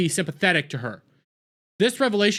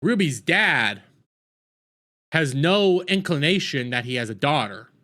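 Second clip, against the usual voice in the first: ordinary delivery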